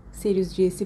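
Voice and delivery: plain reading voice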